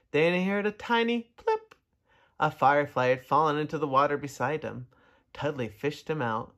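ordinary delivery, nothing marked

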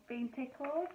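usual speaking voice

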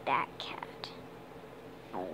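soft, unvoiced whisper; hushed whisper. Real, not acted. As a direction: breathe it out under your breath. Whispered aside with light off.

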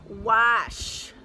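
checking wash with no r; wash again. Shown as a demonstration. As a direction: try wash the standard way. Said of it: "Okay, let's see".

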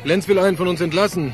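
tinny voice through a phone line. Acted. Anruf über Telefonzelle. (Stimme durchs Telefon)

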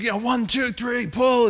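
high, loud counting yell; group count shout. Retold or imitated. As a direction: roar the count like a battle charge. Team pulling snake like Braveheart.

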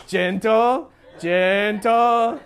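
drawn-out, sing-song gentle with a rising end; mock soothing. Preacher jokingly checking himself before the congregation, mock soothing. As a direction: stretch each word out, lilting upward as a playful caution. Joking self-restraint after judging jab.

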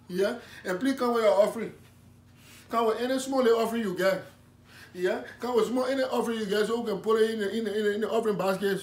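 put-on loud, fervent West African preacher accent; comic West African accented preaching; West African pastor.

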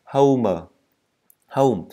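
emphatic "howm" for "home"; correct diphthong. Shown as a demonstration. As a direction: drill "home" as separate falling words. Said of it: "hãy đọc là home".

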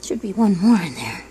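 normal talking voice

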